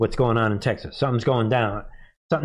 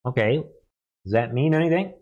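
normal talking voice

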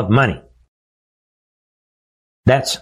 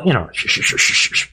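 shushing; faster than usual. Pretend. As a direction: hush them like a secret. Mock hushing about quiet order.

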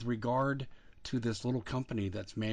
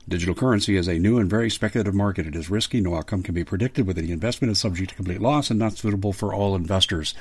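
disclaimer read; 2 semitones lower, 11 dB louder than usual. Acted.

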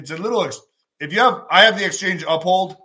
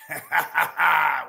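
big laugh. Real, not acted. Laughing at himself.

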